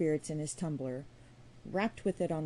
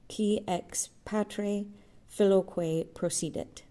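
louder, slowing Latin like a priest leading a congregation; priestly intoning; 4 dB louder than usual. A narrator's reading. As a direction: lead the congregation in the creed. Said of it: "raising it ... a priest leading a congregation".

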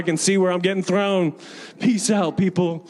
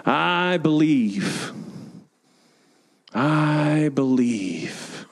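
slow declaration; much slower than usual. Shown as a demonstration. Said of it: "Say that with me".

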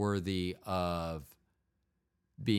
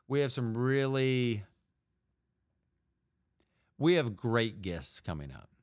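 normal talking voice

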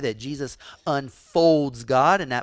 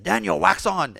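higher, louder sharp command; commanding Miyagi; 6 semitones higher, 6 dB louder than usual. Retold or imitated. Preacher voicing Miyagi commanding Daniel, louder. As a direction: bark the order mid-fight. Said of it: "And he said".

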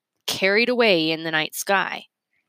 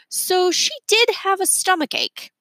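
plain reading voice

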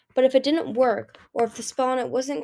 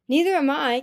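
higher, louder yell; 6 semitones higher, 5 dB louder than usual. A narrator's reading. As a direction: yell it in defiance. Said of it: "Kinkajou yelled".

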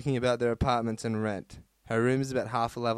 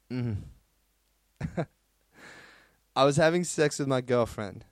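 ordinary delivery

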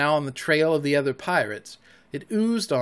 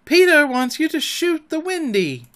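shrill, high, loud fairy voice; shrill little fairy command; Tinker Bell; 11 semitones higher, 5 dB louder than usual. A narrator's reading. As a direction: ring it out in a high, piercing order. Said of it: "shrill voice of Tinker Bell".

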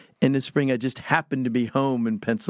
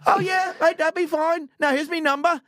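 very high, chipper Australian caller; Australian accent. Retold or imitated. Coach wrapping up the job call, high Australian accent. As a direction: put on a broad, high-pitched Australian accent. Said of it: "was real Australian accent".

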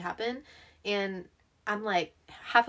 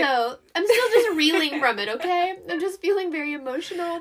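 laugh-crying; 6 semitones higher, 8 dB louder than usual. Real, not acted.

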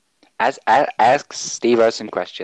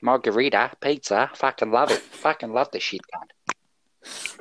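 put-on Australian accent; 6 semitones higher than usual. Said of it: "he is, in fact, from Australia".

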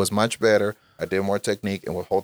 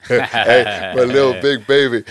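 put-on doting baby talk; 6 dB louder, slower than usual. Doting on his son.

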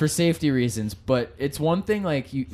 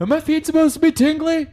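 much higher, louder mock whiny skater; whiny complaint; 6 dB louder than usual. Skater asking Tom about numb feet, high whiny voice. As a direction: squeak it like a worried kid.

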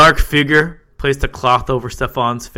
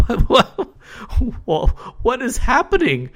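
panicked disbelief; 12 semitones higher than usual. A narrator's reading. Panicked victim.

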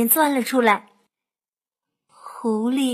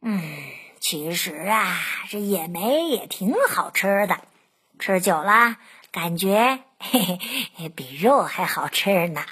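low, musing fox voice; fox talking to himself; 7 semitones lower than usual. A narrator's reading. Fox eating leftover berries, musing low to himself. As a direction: muse to yourself low, with a little chuckle. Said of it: "自言自语地说".

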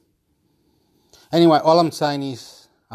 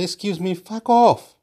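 telling someone off; 9 semitones higher than usual. Retold or imitated. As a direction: snap it at someone pestering you. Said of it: "I have to sometimes say".

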